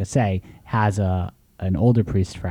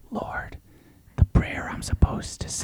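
fully whispered, very soft; whispered prayer; 7 dB quieter, slower than usual. Retold or imitated. Forgetful priest covering a blank at the altar, whispered prayer. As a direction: whisper it under your breath like a private prayer. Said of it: "it's a whispered prayer".

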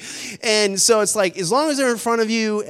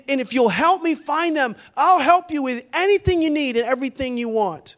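raised, stretched-out preacher's appeal; impassioned plea; 6 semitones higher, 3 dB louder than usual. Acted. God pleading with listeners to find his kids, impassioned. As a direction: implore them, high and emphatic.

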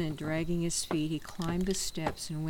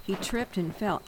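ordinary delivery, nothing marked

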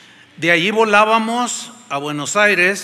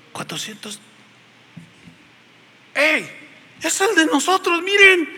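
excited discovery; 10 semitones higher than usual. Retold or imitated. Preacher spotting his flight among the cancellations, excited. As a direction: point and call out, thrilled.

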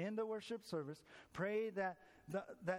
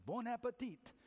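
a light French lilt on Bon appetit, said higher; French toast; 6 semitones higher than usual. Retold or imitated. Frenchman toasts the American at lunch, lightly French. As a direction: raise a glass and say Bon appetit with a hint of French. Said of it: "French man raised his glass and said".

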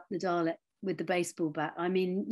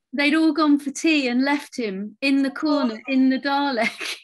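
laughing; 6 semitones higher, 6 dB louder than usual. Real, not acted. Laughing while telling the story.

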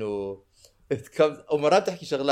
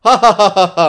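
loud spelled-out ha ha; counting the laughs aloud; reading out laughter; 6 semitones higher, 11 dB louder than usual. Retold or imitated.

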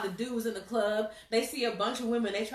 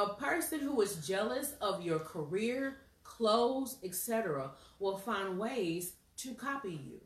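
ordinary talk

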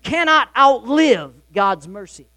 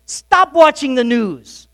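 firm plea; 5 semitones higher, 6 dB louder, slower than usual. Real, not acted. Pastor urges congregation to stop.

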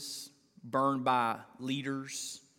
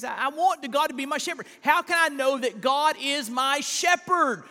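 sudden high, loud preaching burst; full-force preaching; 12 semitones higher, 9 dB louder than usual. Acted. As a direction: cry the question out to the room. Preacher's peak question.

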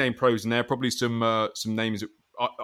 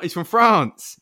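mock insistence; 8 semitones higher, 9 dB louder, slower than usual. Repeated joke about France.

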